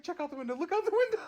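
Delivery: very high, urgent, with laughs; frightened urging. Retold or imitated. Audrey to her partner, scared and urgent. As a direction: urge him to the window in alarm. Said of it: "She's like".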